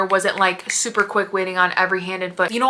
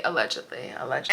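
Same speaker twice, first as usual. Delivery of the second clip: allegedly said much lower and softer, under the breath; hushed aside; 6 semitones lower, 5 dB quieter, faster than usual. Shown as a demonstration. Repeats allegedly, dropping low.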